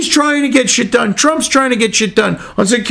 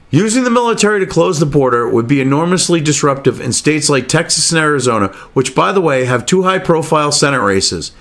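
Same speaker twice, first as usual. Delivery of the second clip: lower, measured reading-aloud voice; news article read; 8 semitones lower than usual. Acted. Reading the BBC piece aloud to viewers, measured read.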